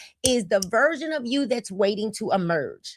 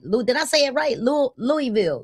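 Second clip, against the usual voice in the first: usual speaking voice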